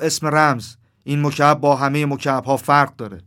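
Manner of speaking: forceful warning from a sentry; stern demand. A narrator's reading. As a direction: insist firmly, like a guard. Crow sentry repeats the demand.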